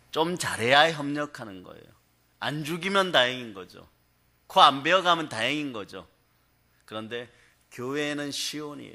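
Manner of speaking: ordinary talk, nothing marked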